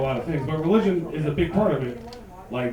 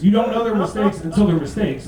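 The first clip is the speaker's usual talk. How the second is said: ordinary delivery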